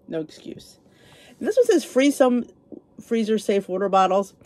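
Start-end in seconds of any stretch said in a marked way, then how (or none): 0.1-0.8 softly breathed under her breath, almost unvoiced; whispered afterthought to herself; quiet repeat. Shown as a demonstration. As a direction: say it again to herself, hushed. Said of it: "I have no excuse".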